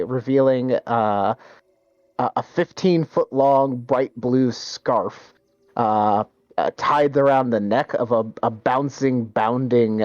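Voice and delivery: ordinary talk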